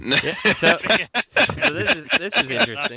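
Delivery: high, loud, drawn-out showman voice; a hammed-up announcer; mock announcer. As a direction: present it like a game-show host. Announces next product mockingly.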